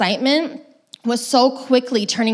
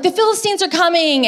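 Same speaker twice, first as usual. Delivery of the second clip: high mock yell of alarm; 9 semitones higher than usual. Delilah calling out to the Philistines, a high mock yell. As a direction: call the warning out high and bright. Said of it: "and then yells out".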